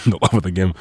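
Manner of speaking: chuckling through the phrase; laughing. Real, not acted. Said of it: "laughs, repeats phrase".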